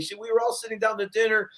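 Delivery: ordinary talk, nothing marked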